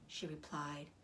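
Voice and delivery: plain reading voice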